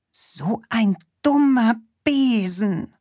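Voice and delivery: high, cross witch voice scolding her broom. Acted. The witch scolds her broken broom, high and cross. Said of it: "Die Hexe Allerlei schimpfte".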